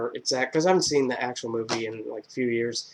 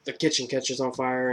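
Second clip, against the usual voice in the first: normal talking voice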